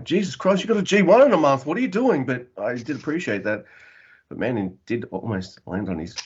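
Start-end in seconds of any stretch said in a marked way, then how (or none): 0.0-2.3 high, loud mock scolding; exasperated disbelief. Fan scolding a wrestler for a risky move, exasperated disbelief. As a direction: fire it off in high-pitched disbelief.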